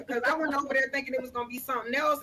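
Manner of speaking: ordinary talk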